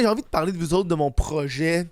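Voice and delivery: usual speaking voice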